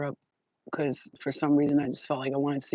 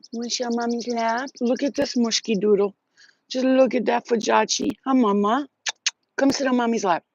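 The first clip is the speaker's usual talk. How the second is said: higher sing-song pet talk; 5 semitones higher than usual. Real, not acted. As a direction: coo at the little animal. Talking to a pet.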